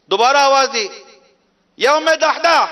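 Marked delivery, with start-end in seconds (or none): 1.8-2.6 calling out. Retold or imitated. Calls wife again from outside.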